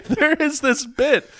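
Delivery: laughing up high; giggly high start; high laughing squeal. Real, not acted. Laughing squeal into next bit.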